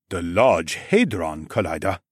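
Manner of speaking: frowning man's question. Acted. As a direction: ask it back, frowning and doubtful. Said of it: "Minjun asked with a frown".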